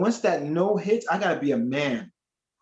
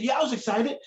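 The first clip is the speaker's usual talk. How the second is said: higher-pitched, eager agreement; acted-out eagerness, pitch raised; eager suitor; 7 semitones higher, faster than usual. Admirer seizing his chance with church sister, eager.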